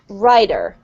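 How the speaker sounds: rider with American D and final R; D sound for T. Shown as a demonstration. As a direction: say rider slowly, American-style. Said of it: "It's pronounced like this".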